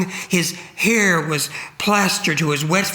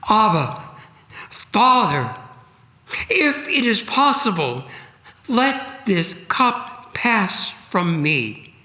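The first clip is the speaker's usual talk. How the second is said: anguished prayer; 5 semitones higher than usual. A narrator's reading. Jesus praying in agony.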